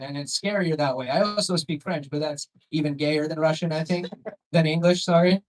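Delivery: ordinary talk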